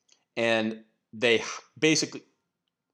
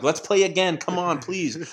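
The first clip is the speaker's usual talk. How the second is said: high, pleading Kramer-style plea; a loose Kramer impression, begging for a rematch; 7 semitones higher, 3 dB louder than usual. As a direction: beg like Kramer chasing him down. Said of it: "he's channeling Kramer".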